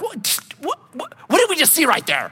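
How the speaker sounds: high, stammering what-what; stunned stammering question to the interpreter; stunned disbelief. Retold or imitated. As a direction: sputter out the question in shock. Asking interpreter in shock.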